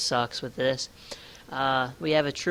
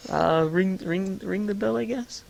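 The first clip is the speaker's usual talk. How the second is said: hesitant, rising higher and higher; unsure referee; 7 semitones higher than usual. Acted. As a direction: play a baffled ref, stammering the call upward. Acting the confused referee's unsure call.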